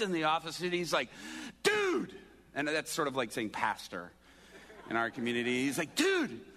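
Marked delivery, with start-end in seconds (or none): 1.6-2.1 high, loud mock-panicked cry; imitated urgent holler; alarmed call. Pastor bursts in, alarmed. 5.9-6.4 high, loud mock-panicked cry; imitated urgent holler; alarmed call. Pastor bursts in, alarmed.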